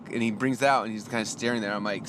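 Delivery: ordinary talk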